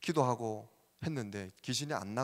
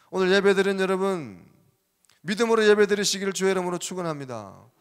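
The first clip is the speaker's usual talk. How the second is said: loud ringing pulpit blessing; preaching blessing; 5 semitones higher, 9 dB louder than usual. Acted. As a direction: proclaim it over the congregation. Preacher's blessing at full force. (회중에게 큰 소리로 축복을 선포하는 설교)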